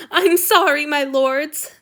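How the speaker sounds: high, loud wail of apology. A narrator's reading. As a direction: cry out in misery. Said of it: "the young man wailed".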